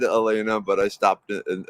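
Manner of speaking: normal talking voice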